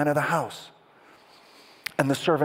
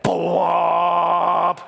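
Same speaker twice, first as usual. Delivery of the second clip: loud, long held "bwomp"; big cinematic bwomp, drawn out; movie boom imitation; 5 semitones higher, 7 dB louder than usual. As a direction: boom out a trailer sting with your voice. Climactic boom cue with audience.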